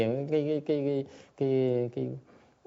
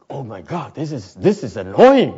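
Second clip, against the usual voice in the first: imitating an irritated meditator, louder at the end; exasperated grumble, swelling much louder on annoying; mock annoyance; 4 semitones higher, 10 dB louder, slower than usual. Meditator irritated by crying baby. (giả giọng bực bội khi nghe em bé khóc)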